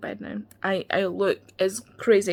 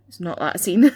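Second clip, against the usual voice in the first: louder, punched with a laugh; loud insistence; 2 semitones lower, 9 dB louder than usual. Real, not acted. Repeated denial, then laughs.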